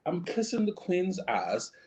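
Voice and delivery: hammed-up English accent, badly done; clumsy posh British impression. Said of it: "That was the worst accent ever".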